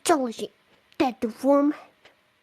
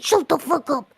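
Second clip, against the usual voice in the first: loud, higher mock yell; retold scream; 4 semitones higher, 7 dB louder than usual. Reader quoting someone who started screaming, retold yell. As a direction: shout it as the screamer did. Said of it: "Matt Pitt started screaming".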